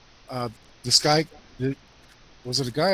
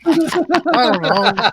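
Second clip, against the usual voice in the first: laughing; 6 semitones higher, 7 dB louder, much slower than usual. Real, not acted. Laughing repeat of own words.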